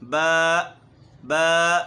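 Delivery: با with a jolt at the end; wrong ba. Shown as a demonstration. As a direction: show the error in با loudly. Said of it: "اب غلط ہم کیا کرتے ہیں". (با غلط، آخر میں جھٹکا)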